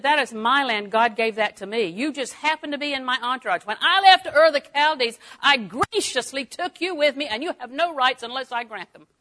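forceful, rising self-righteous protest; indignant claim. Acted. Abraham as he could have answered Lot, indignantly.

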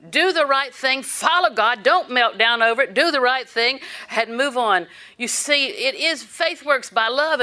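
firm, emphatic exhortation; urging the congregation forward; preaching. Acted. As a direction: drive the point home.

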